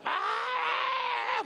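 very high, sustained scream; high-pitched mimicked uproar; imitated crowd screaming. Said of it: "escucho una gritería de este lado".

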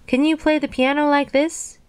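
high-pitched showing-off question; boastful cry. A narrator's reading. Toad on stage challenges Frog, boastful high cry. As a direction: show off to a friend in a high voice. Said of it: "cried Toad".

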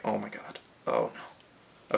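ordinary talk

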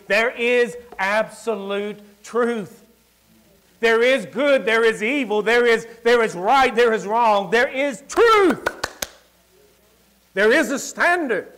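rising fiery sermon build; preaching. Acted. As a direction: hammer each there is. Preaching at full force.

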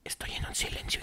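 whispered aside into the quiet; mock whisper. Joking about the awkward silence, whispered. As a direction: breathe it like a stage aside.

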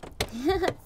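light laugh. Real, not acted. Laughs at own joke.

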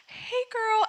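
put-on high, sing-song hey girl; high friendly greeting. Friend replying warmly.

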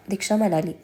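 plain reading voice

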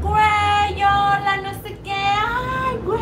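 high, drawn-out, singsong hellos; mock gushing greeting. Great friends meeting at expos, mock gushing greeting. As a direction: ham up a long, high greeting between pals.

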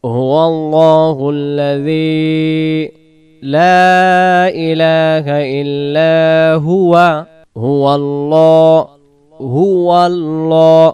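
slow, drawn-out melodic recitation; Quran recitation. Acted. Teacher reciting the verse slowly for students to repeat. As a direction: chant each phrase slowly, stretching the vowels.

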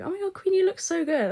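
high, put-on gush; mock-adoring high voice; gushing fans. Imitates gushing commenters.

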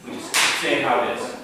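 mock charismatic bragging; charismatic pastor. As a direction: talk straight like a no-nonsense preacher.

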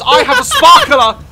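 excited. Shown as a demonstration. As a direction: announce it with excitement.

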